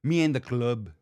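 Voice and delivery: imitated British slip in an American accent; mock a Brit failing at American "club"; put-on, imperfect American accent on "club"; Jax impression. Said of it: "could not say club in an American accent".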